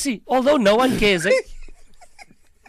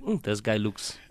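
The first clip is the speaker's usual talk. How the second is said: suspicious mutter; 10 semitones lower, 9 dB quieter than usual. Retold or imitated. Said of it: "a guy in the queue that was like".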